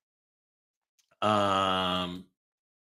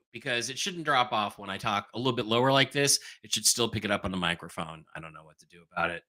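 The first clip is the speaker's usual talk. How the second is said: usual speaking voice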